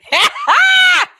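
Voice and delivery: loud, very high cackle; delighted squeal-laugh. Real, not acted. Accent lover thrilled by the greeting, shrieking laugh.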